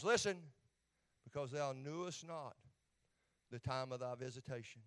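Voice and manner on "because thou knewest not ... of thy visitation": sorrowful reading. Acted. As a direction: read it as if grieving over the city. Reads Jesus weeping over city.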